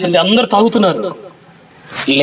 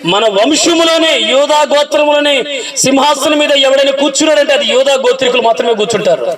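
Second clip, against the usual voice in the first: higher, slowing, rising to a fervent promise; fervent preaching; 8 semitones higher than usual. Acted. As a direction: build the promise like a proud father. David exhorting son, preaching. (ఉత్సాహంగా ఉపదేశించే తండ్రి)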